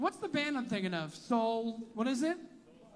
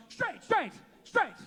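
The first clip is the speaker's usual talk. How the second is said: higher, louder rushed insistence; mock defensive straight guy; 8 semitones higher, 4 dB louder, slower than usual. Comic imitating straight guys answering defensively. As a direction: play the panicked straight guy. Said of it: "They go".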